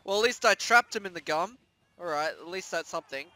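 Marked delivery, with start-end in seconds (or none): none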